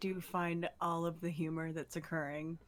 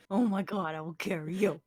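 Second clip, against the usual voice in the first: excited eager voice; 8 dB louder than usual. Acted. Excited offer to carry friend.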